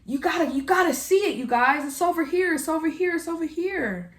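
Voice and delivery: high, eager pleading voice; urgent mock plea, high and bright-eyed; pleading excitedly. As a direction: beg them to come see, pitched well up. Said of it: "and you're like".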